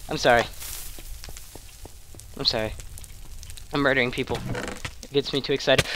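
very soft, low murmured apology; sheepish apology. Real, not acted. Gamer apologising for getting too excited, hushed and low. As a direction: drop right down after the screaming.

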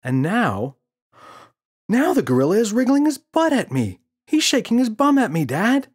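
put-on very high, loud child voice; excited child. Boy telling father the gorilla dances, excited. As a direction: gush it like a thrilled kid.

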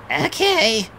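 squeaky high helium. Acted. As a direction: cheeks full of air, squeak. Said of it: "he said in a helium voice".

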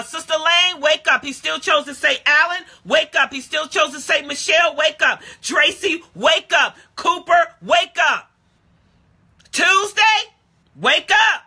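high, punched roll call from the pulpit; full-force preaching. Acted. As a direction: call each name out like a wake-up summons.

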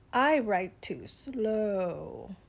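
very slow, drawn-out turtle voice; slow turtle drawl. Acted. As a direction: stretch the words out like a sluggish turtle.

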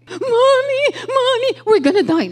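put-on high, crying cry of a terrified child; panicked child crying. Son waking his mother during turbulence, crying in panic. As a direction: wail it like a scared kid in turbulence. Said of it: "Ginising ako ng anak ko".

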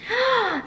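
a sharp, high delighted gasp; excited gasp. Acted. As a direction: gasp as if the book thrills you. Excited gasp over the book.

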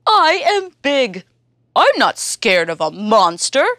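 big, loud boastful elephant voice; proud elephant. Acted. Elephant boasting to Hare and Monkey, loud and proud. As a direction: puff up and boast. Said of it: "says Elephant".

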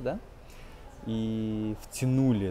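ordinary delivery

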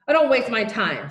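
exasperated headmaster's rebuke; snapped. A narrator's reading. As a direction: cut them off impatiently, drawing the words out. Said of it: "snapped Mister Johnson".

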